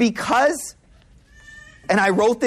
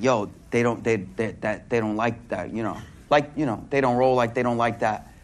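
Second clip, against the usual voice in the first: low, soft aside, muted and hushed; retold whisper; 12 semitones lower, 6 dB quieter than usual. Companion quietly warning Abu Hudhaifa at the table, hushed low aside. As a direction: murmur the warning so the dignitaries won't hear. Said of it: "And then he whispers".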